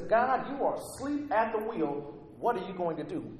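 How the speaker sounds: high, stretched-out exasperated challenge to God; frustrated plea, pitched high then dropping; frustrated Habakkuk. Acted. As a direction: demand answers in mounting exasperation. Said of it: "He's frustrated".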